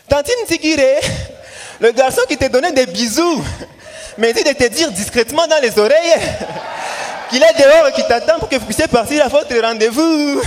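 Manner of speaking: put-on high, loud kid yelling a message; shouting across the yard. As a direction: yell it so the whole street hears. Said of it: "il se met à crier". (cri d'enfant à tue-tête)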